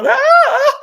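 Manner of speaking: put-on high, loud, girlish shriek with a laugh; mock scream like a little girl; hammed-up girlish shriek; girly scream. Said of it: "I'd scream like a little girl".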